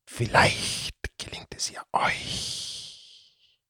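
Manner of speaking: put-on slow, hushed whisper of an old cat-serpent; whispering serpent creature. The Datzelwurm confiding the curse to the children, hushed whisper. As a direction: tell the legend in a secretive whisper.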